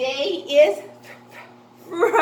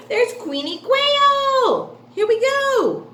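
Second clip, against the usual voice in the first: excited announcement; 9 semitones higher, 5 dB louder than usual. Shown as a demonstration. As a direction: present her like a star. Announcing character to kids.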